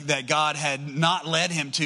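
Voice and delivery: ordinary talk, nothing marked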